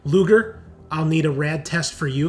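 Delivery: ordinary talk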